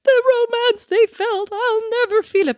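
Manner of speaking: high, slow, mournful whine; melodramatic teen lament. Retold or imitated. Young self crying over film romance, high slow wail.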